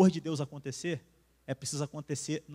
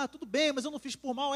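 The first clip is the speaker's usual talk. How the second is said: a higher, meek wife's excuse; wife answering her husband, mild higher apologetic voice; lightly voiced wife; 9 semitones higher than usual. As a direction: play the wife shrugging it off lightly.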